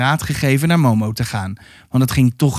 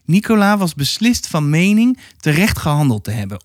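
ordinary delivery, nothing marked

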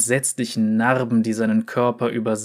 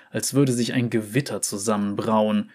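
ordinary delivery, nothing marked

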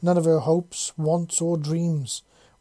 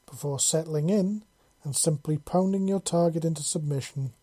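ordinary delivery

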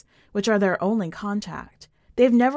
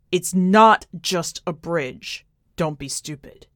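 slow, louder insistence on not; firm rebuke; 3 semitones lower, 5 dB louder, slower than usual. Acted. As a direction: lean hard on each word, then drop it low. Sam arguing against crossing bridge.

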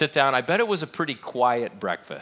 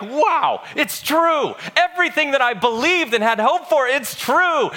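much higher, louder, overjoyed cry of belief; awed elation; 11 semitones higher, 5 dB louder than usual. Acted. Peter hearing of the resurrection, overjoyed and loud. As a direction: burst out in thrilled amazement. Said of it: "part of him is probably like".

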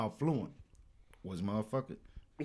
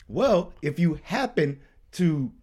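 ordinary delivery

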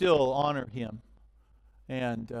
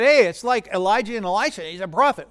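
excited crowd; 5 semitones higher, 8 dB louder, faster than usual. Retold or imitated. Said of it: "So they said".

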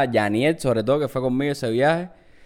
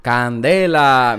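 slow dismayed candela; 4 semitones lower, much slower than usual. Retold or imitated. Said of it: "tú dices".

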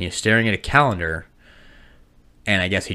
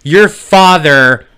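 slow solemn mom; 2 semitones higher, 8 dB louder, slower than usual. Retold or imitated. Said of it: "she decides to go".

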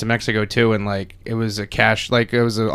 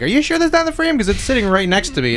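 high-pitched, doubtful pushback; sceptical questioning; 10 semitones higher, faster than usual. Retold or imitated. Actor doubting the crew about the frame, sceptical questioning. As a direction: push back in a higher, incredulous voice.

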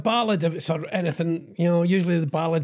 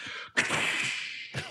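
whip crack; 9 dB quieter than usual. Shown as a demonstration. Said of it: "make a whip noise".

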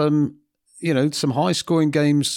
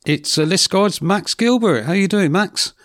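higher, louder presenter read; radio host intro; 6 semitones higher, 4 dB louder than usual. Acted. Radio host bringing the top team's skipper on air, upbeat and louder. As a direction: announce the guest like a radio host.

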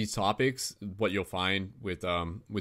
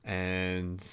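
whispered aside; 5 dB quieter than usual. Real, not acted. Host waiting on browser crash.

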